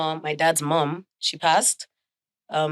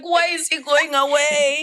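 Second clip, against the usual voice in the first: mock sad whine; 10 semitones higher, 6 dB louder, slower than usual. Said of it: "I'm like".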